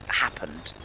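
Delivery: ordinary talk, nothing marked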